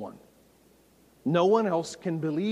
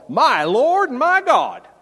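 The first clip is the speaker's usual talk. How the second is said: loud, high, stretched-out Southern exclamation; hammed-up Southern drawl, slow and booming; 10 semitones higher, 11 dB louder, slower than usual. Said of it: "my best Southern drawl".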